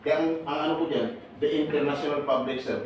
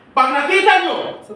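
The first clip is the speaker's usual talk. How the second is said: louder, higher and slow, selling it; excited pitch; 13 semitones higher, 10 dB louder, slower than usual. Real, not acted. Lecturer urges trainees to find his channel, excited. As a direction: hype the plug.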